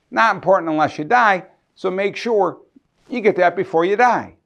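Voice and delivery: sarcastic. Real, not acted. Estate lawyer joking to clients about making a will, sarcastic. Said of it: "I'm being sarcastic".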